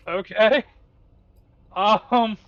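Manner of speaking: loud rising okay, taken aback; startled. Real, not acted. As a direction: caught off guard, loud and high. Reacts to Max's death.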